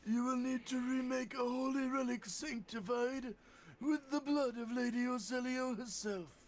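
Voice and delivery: high, strained and wheezing; wheezy voice. Acted. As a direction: wheeze out every word like an asthmatic. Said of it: "do a wheezy voice like you were doing earlier".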